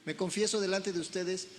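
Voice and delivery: ordinary delivery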